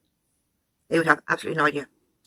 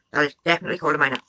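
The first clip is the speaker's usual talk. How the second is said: rapid insistence; 2 semitones lower, much faster than usual. Real, not acted. Commentator rebuts, repeats point.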